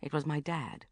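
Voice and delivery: ordinary delivery, nothing marked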